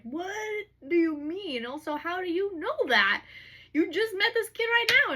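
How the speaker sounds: high, loud acted disbelief, first word stretched; incredulous protest. Hunt challenging Bryce about the kid, incredulous. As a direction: demand an explanation, pitch climbing as you go.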